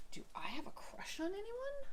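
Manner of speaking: a high, soft whisper to herself; whispered musing. Real, not acted. Quietly asking herself about fictional crushes, whispering. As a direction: wonder aloud in a hushed whisper.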